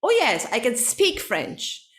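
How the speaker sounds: "can" quick and unstressed, "speak" stressed. Shown as a demonstration. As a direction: lean on "speak", pass quickly over "can". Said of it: "the stress is on speak".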